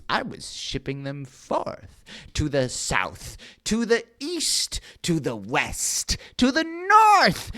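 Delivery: lightly voiced storyteller villain; Once-ler voice. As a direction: narrate as the proud Once-ler.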